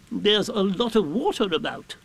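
high-pitched owl voice; Owl explaining. A narrator's reading. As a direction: explain it as a patient, learned owl. Owl explains in plain words.

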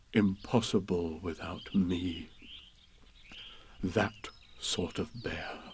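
very slow, low, soft self-satisfaction; quietly proud. A narrator's reading. Pooh congratulates himself in a corner, quietly proud. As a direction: savor each word privately. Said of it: "said proudly to himself".

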